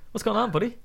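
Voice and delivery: ordinary delivery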